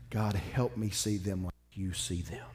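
soft, slow and sincere, almost whispered to God; tender prayer. Retold or imitated. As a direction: ask God gently, from the heart.

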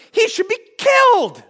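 high, loud acted shout of outrage; furious retold cry, pitched right up; angry outburst. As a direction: shout the verdict as an enraged king. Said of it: "David becomes f-, so angry".

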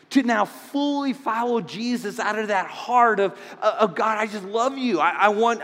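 loud, high, building sermon climax; fervent pulpit preaching at full voice; impassioned preaching. Real, not acted. Pastor preaching at full force.

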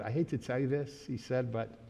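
low, subdued retelling of bad news; mildly downcast, hesitant delivery; reluctant bad news. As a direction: break it gently, a little low. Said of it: "he had a funny sound in his voice".

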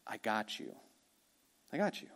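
soft, close, comforting; tender reassurance. Acted. Jesus promising the disciples he has them, tenderly soft. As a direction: hold them up with your voice.

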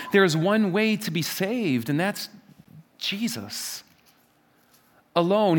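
ordinary talk

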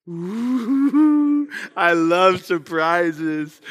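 hammed-up childish delight. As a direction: imitate a little girl thrilled by a secret. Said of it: "And her eyes".

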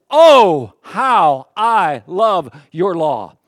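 booming, very slow exclamation; passionate. Acted. Pastor capturing the psalmist's passion for God's law, passionate. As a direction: punch each word slowly, loud and full of passion. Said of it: "try to capture his passion here".

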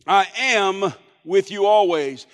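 "I am" drawn out and punched, then falling away; weighty "I am", lighter rest; stress on I AM. Shown as a demonstration. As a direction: lean hard on the words "I am". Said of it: "I put the emphasis on those first two words".

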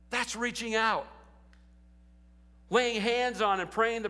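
high, loud, building sermon climax; preaching at full force. Acted.

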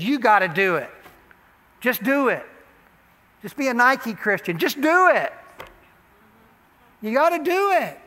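rising from firm to loud shouted urging; building exhortation. Acted. Pastor urging the church to act, building to a shout. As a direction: push the congregation harder each time.

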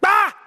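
short hammed-up scream of fright; mock fearful cry. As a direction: act out the disciples' yelp of fear. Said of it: "They cried out for fear".

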